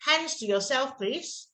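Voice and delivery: put-on politely redirect the child; gentle instruction. Shown as a demonstration. Models the positive instruction instead.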